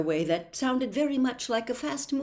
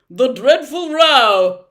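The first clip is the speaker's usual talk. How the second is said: loud, high, eager answer; bright volunteering; 6 semitones higher, 14 dB louder, slower than usual. A narrator's reading. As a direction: pipe up brightly, stretching the last word. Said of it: "volunteered the humbug brightly".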